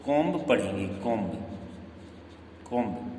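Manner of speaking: कॉम्ब repeated with an audible final B; B sounded. Shown as a demonstration. Teacher demonstrates the wrong reading, कॉम्ब with B sounded. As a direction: release the B after M in each कॉम्ब. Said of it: "this is wrong".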